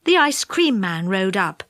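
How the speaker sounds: plain reading voice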